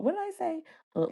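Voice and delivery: usual speaking voice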